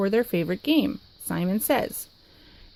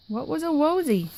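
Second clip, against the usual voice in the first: ordinary delivery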